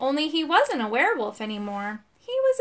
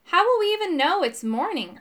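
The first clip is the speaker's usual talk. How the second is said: high-pitched, mildly gloomy complaint; understated gloom, pitched up; 7 semitones higher, 4 dB louder than usual. A narrator's reading. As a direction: ask it as a glum whine. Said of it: "Urk asked gloomily".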